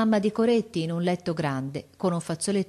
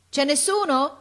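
a woman calling out high into the shop; high, loud call of a customer at the shop; 10 semitones higher, 4 dB louder than usual. Acted. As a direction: call out for someone from the doorway. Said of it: "s'udì gridare".

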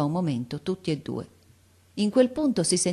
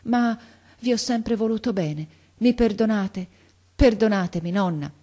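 higher, halting plea for forgiveness; tremulous pleading; 6 semitones higher, 4 dB louder than usual. A narrator's reading. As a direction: beg forgiveness with effort. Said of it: "con la voce tremola". (supplica con voce tremola)

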